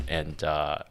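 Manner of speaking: usual speaking voice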